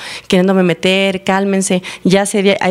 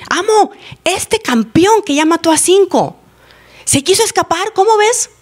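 acted underling calling up to the boss; tattling to the master; 10 semitones higher than usual. Slaves tell the master about an escape, tattling.

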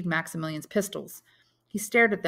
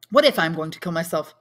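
loud, rushed outburst; agitated cry; 8 dB louder, faster than usual. A narrator's reading. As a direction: drop the calm and cry out fast. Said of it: "cried Maximilian, abandoning his pretense of calm".

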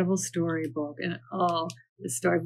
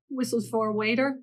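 ordinary delivery

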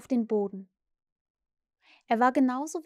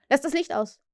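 called out; 2 semitones higher, 3 dB louder than usual. A narrator's reading. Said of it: "rief er".

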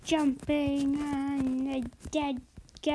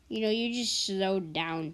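ordinary talk

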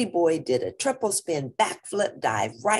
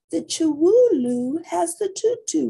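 sister voice; 13 semitones higher, 6 dB louder than usual. Acted. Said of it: "replied Jujubee".

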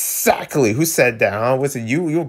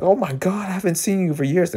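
mock reunion greeting; 6 semitones higher, faster than usual. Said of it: "it's like going for a hug".

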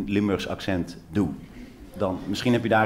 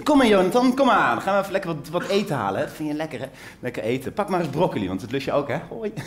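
mother in the supermarket; 5 semitones higher than usual. Retold or imitated. Mother performing for the supermarket, cooing at her child. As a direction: put on a show for the shoppers with your toddler. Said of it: "Zij deed dit".

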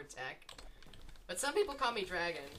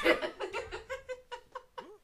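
long high laugh; disbelieving laughter. Real, not acted. Gamer astonished at own result, high helpless laughter.

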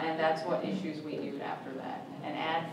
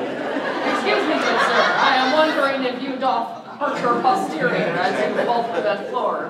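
mock posh pony; 5 semitones higher, 11 dB louder than usual. Panelist parodying the edited voice, loud and pompous. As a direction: ham up a prissy gentleman pony.

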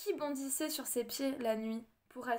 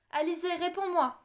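desperate call; 6 semitones higher, 7 dB louder than usual. A narrator's reading. Servant calling lost child.

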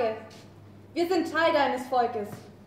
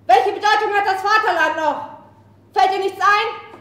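rising from firm to furious shouting; speech that swells into rage; impassioned rebel, louder and angrier with each question; building fury; 3 semitones higher, 7 dB louder than usual. Acted.

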